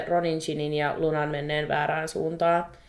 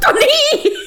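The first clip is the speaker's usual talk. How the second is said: much higher and louder triumphant cry; excited shout; 9 dB louder than usual. Real, not acted. Cohost agrees with Bellatrix, excited shout. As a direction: shout it like you finally got vindicated.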